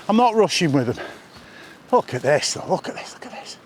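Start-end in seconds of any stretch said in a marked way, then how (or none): 2.7-3.6 soft awed whisper. Real, not acted. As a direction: hush it, breathe the words.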